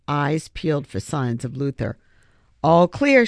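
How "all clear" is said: raised call from below. A narrator's reading. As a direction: call it up to the others. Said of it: "she called up".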